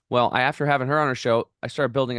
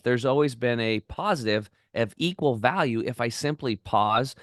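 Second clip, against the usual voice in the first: normal talking voice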